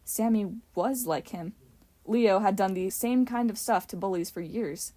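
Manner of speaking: usual speaking voice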